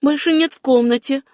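high-pitched echo of relief; Susanna echoing. A narrator's reading. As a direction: repeat it high, savouring each word. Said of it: "повторила Сюзанна".

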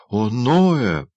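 high, loud wounded protest; reproachful exclamation. A narrator's reading. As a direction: cry out his name in reproach. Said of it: "с упрёком воскликнула Шарлотт". (с упрёком)

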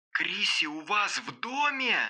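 slow, high, distant cry of horror; horrified. A narrator's reading. Девушка узнаёт, что Криси в доме, horrified. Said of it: "в ужасе воскликнула девушка".

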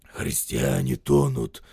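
low, loud hoarse growl; hoarse grumble. A narrator's reading. Soldier protesting over the drowning, hoarse grumbling. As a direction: growl it slowly through a hoarse throat. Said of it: "ворчит солдат, ещё более охрипший".

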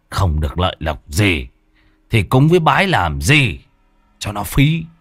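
put-on a touch of a drunk son's angry jeer. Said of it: "Nó lớn giọng".

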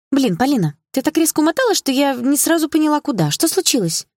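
high, animated exclamation; lively girlfriend demanding an explanation; agitated friend. A narrator's reading. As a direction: burst in with high-pitched bewilderment. Friend's agitated questioning on arrival.

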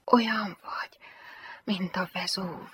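soft, breathed flirtation; hushed, suggestive whisper. A narrator's reading. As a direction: murmur it intimately, with a pause midway. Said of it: "közben azt susogta".

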